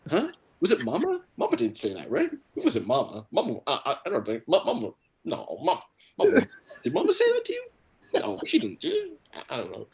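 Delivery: loud, flustered, pitch jumping high; hammed-up panicky interrogation; defensive. Insulted dad questioning his son about Mama, loudly defensive. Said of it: "I started getting defensive too".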